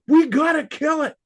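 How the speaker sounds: forceful high repeat; oomph. Retold or imitated. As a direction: put real force behind it. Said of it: "really puts some oomph behind it".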